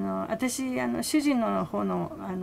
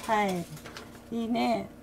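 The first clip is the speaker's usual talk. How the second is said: normal talking voice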